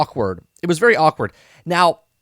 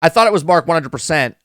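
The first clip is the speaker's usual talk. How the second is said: usual speaking voice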